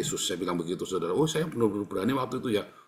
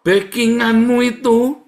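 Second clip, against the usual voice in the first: high, loud, drawn-out proclamation; emphatic preaching shout; 12 semitones higher, 14 dB louder, slower than usual. Real, not acted. Preacher telling believers who truly backs them, emphatic shout. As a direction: belt it out slowly from the pulpit. Preacher drives home key point.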